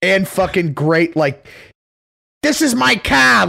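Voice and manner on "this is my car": put-on slow, higher, louder Boston accent. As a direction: plant your feet and claim it in thick Boston. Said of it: "great Boston accent".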